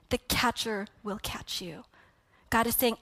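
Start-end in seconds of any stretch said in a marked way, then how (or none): 0.1-1.8 slow, whispered promise; slow whispered reassurance; hushed reassurance. Acted. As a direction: breathe it like a comfort.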